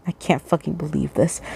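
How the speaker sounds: low, soft grumble under the breath; grumbling. Acted. The beaten attacker grumbling to himself, low and soft. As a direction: mutter it, fed up and beaten. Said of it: "he grumbles".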